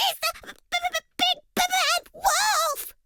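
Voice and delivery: very high, stuttering piglet; chattering with fear. A narrator's reading. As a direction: stammer in terror, teeth chattering. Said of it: "Boris chattered".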